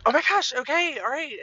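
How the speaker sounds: put-on high, loud kid voice giving in; flustered child. Daughter answers her overreacting mom, high flustered child voice. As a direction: imitate a child's exasperated reply. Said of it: "and she's like".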